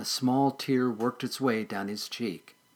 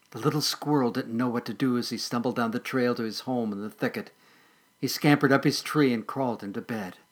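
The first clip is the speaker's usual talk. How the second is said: usual speaking voice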